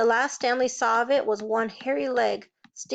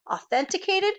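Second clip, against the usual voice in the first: higher, disbelieving repeat of Authenticated; incredulous echo; 8 semitones higher than usual. Acted. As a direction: throw Authenticated back at her. Lawyer echoes in disbelief.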